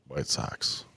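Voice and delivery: hushed, whispered prediction; secretive whisper; conspiratorial whisper. Pretend. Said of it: "Why are you whispering".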